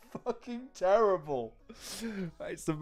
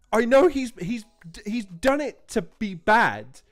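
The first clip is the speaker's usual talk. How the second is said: excited; 6 semitones higher, 5 dB louder than usual. Real, not acted. Excitedly explaining skill.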